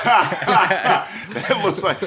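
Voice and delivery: laughing through the words, high; cracking up at a fire hazard. Real, not acted. Laughing at electrical panel.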